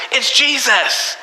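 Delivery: put-on high, slow, sing-song child voice; piping up like eager little kids; kids' eager answer. Sunday school kids blurting out the answer in high child voices.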